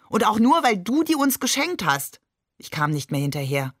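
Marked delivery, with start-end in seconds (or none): 0.1-2.2 higher-pitched protesting exclamation; called-out protest. A narrator's reading. Shakir blames his brother for gifting the hens, called-out protest. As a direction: throw it back at him, pitched up. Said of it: "rief Shakir".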